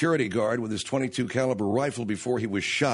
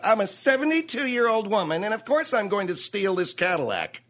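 mocking old woman; 5 semitones higher than usual.

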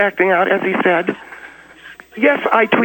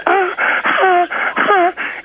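high mock panting; high-pitched "ah" on each breath; imitated breathless gasps; 9 semitones higher, much slower than usual. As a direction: mimic a winded man's high gasps. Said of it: "When Steve gets out of breath, he starts going".

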